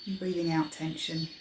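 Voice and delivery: soft, unhurried meditation voice with long pauses; guided meditation. Acted. Meditation leader easing listeners into their breathing, guided meditation. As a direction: guide them gently into relaxation, softly.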